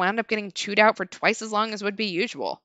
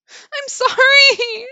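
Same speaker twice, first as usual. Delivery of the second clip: very slow, high, loud crying sorry; wailed tearful apology; 11 dB louder, much slower than usual. Acted. Narrator wails sorry on the woman's demand, crying. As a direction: wail the apology with tears. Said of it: "Say it louder".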